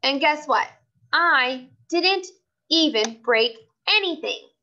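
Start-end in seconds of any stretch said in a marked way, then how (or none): none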